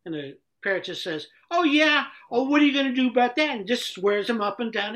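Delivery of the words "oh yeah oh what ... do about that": loud, high, cocky comeback; acted brash challenge, much louder and quicker; defiant retort. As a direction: fire back like a mouthy parrot. Parrot defies owner's threat.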